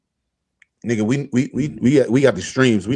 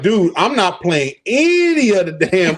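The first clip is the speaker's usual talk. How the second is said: mock coach; 5 semitones higher than usual. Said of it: "Blau said".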